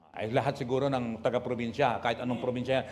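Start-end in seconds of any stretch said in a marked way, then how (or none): none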